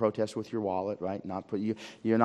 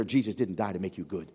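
ordinary talk, nothing marked

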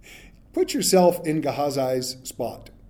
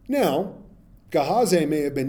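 normal talking voice

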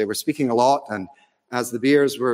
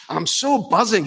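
put-on excited Scottish accent, pitched up; higher, buzzing Scottish voice; 7 semitones higher than usual. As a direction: imitate a thrilled football fan in a Scottish accent. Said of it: "I put a Scottish accent".

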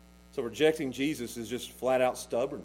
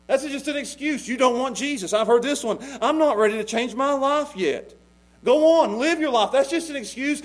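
raised, urgent sermon voice; fiery preaching; 7 semitones higher, 8 dB louder than usual. Acted. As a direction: preach it hard at the congregation. Preaching at full force.

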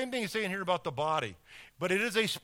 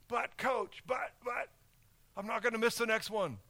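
a softer, higher voice begging to stay in; pleading player; 4 semitones higher, 4 dB quieter than usual. Pretend. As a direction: whine it like a player who won't sit down. Benched player pleads with coach.

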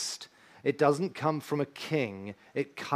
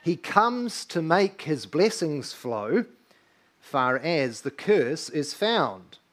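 slow, measured hymn recitation; recited hymn; 3 dB louder, much slower than usual. Acted. Pastor reciting the carol's words slowly to the congregation. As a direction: intone each line like a sung verse.

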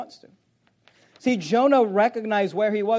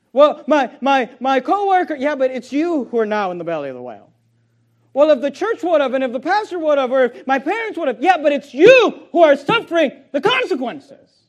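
raised, loud rebuke from the pulpit; full-force preaching; 6 semitones higher, 9 dB louder than usual. Real, not acted. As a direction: preach it hard at the congregation.

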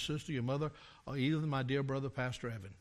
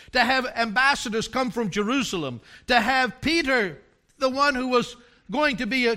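loud, higher fervent sermon climax; full-force preaching; 7 semitones higher, 10 dB louder than usual. Acted. Preacher building to a climax before the congregation, fervent. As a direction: preach it with rising fire.

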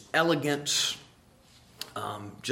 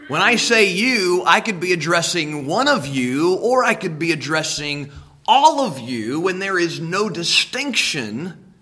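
louder, driving each phrase home; preacher booming at the congregation; preaching loud; 3 semitones higher, 7 dB louder than usual. Real, not acted. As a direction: preach it out to the back pews.

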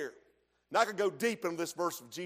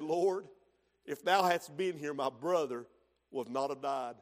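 soft, lower, falling away to a hush; Mary at his feet, humbly devoted; humble, devoted; 5 semitones lower, 3 dB quieter than usual. Retold or imitated. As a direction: kneel and say it in surrender. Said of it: "There's a difference in her disposition".

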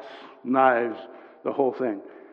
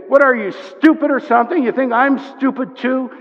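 loud, higher-pitched indignant comeback; harsh retort; 10 semitones higher, 9 dB louder than usual. Acted. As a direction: snap back at the accuser, insulted. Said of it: "it's much stronger".

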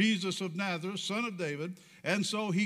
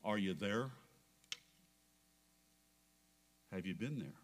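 hushed intimate question; 11 semitones lower, 10 dB quieter, faster than usual. Real, not acted. Preacher asking the congregation, low and soft.

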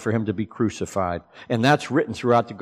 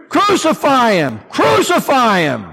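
mock crowd shout; 11 semitones higher, 5 dB louder than usual. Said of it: "they cried out".

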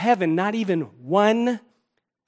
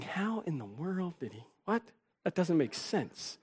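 puzzled onlooker; 2 semitones lower, 17 dB quieter than usual. Retold or imitated. Congregation wondering how he got his wife, soft mock disbelief. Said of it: "and you think".

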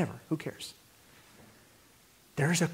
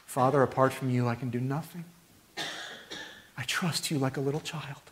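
hushed, humble plea to the Father; childlike prayer; 5 dB quieter than usual. Retold or imitated. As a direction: pray it like a trusting child. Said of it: "childlike trust".

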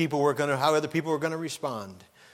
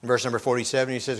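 usual speaking voice

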